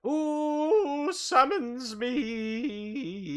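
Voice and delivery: high, slow, drawn-out spirit voice; booming elemental voice. Acted. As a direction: boom it out like an air spirit.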